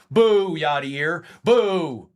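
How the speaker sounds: loud, drawn-out playful boo; mock booing. Reds fan heckling Yadier on stream, mock booing. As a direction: boo him slowly from the stands.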